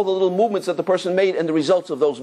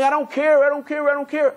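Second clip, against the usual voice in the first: defiant; 10 semitones higher than usual. Retold or imitated. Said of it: "And he tells me".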